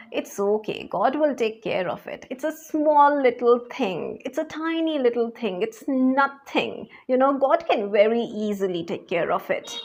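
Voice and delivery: quiet, easy it's okay; soft unbothered self-talk; calm self-reassurance. Retold or imitated. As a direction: soothe yourself softly, nothing to worry about. Her calm self-talk over big problems.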